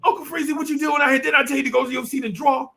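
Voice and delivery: high, puzzled teacher voice; surprised teachers. Retold or imitated. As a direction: ask like a baffled teacher finding a kid outside. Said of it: "They like".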